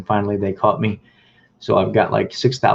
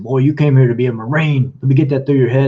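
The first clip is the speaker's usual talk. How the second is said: tough recruiter yelling; 4 semitones higher, 5 dB louder than usual. Retold or imitated. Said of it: "starts getting tough with me".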